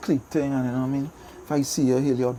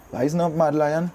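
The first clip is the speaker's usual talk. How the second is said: normal talking voice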